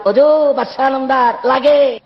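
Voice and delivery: drawn-out call to the king. Acted. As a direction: stretch it out like an announcement. Executioner calls out to the king. (টেনে টেনে ডাক দেওয়া)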